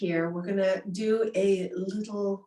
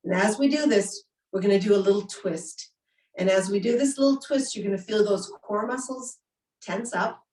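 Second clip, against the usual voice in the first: louder, pumped-up class cues; energetic yoga instructor; 2 semitones higher, 4 dB louder than usual. Acted. Leads breath of fire.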